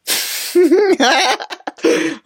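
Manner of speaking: real high-pitched laughing fit; high giggle tailing into breath; helpless giggle. Real, not acted. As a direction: crack up at the memory. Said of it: "he makes me laugh every time".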